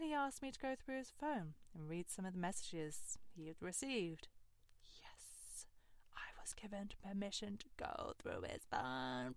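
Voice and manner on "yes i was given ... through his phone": hushed, secret aside; conspiratorial whisper. Real, not acted. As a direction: lean in and whisper it. Whispered aside about phone permission.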